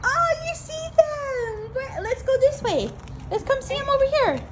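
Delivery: eager parent voice, up high; excited encouragement. Real, not acted. Encouraging a toddler to look.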